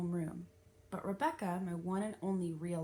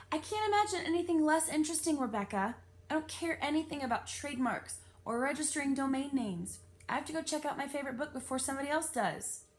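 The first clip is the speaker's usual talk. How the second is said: imagined outburst; 7 semitones higher, 3 dB louder than usual. A narrator's reading.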